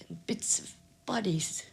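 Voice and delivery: whispered, barely voiced; hushed whisper. Real, not acted. Old woman recalling falling bodies, whispering. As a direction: drop to a horrified whisper. Horrific memory of the dogfight.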